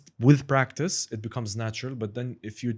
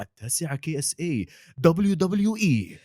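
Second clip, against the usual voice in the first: higher, then stretched WWE hype; Arabic sports promo announcer; 7 semitones higher, 3 dB louder than usual. Shown as a demonstration. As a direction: hype the channel like a wrestling promo. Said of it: "becomes a trigger word".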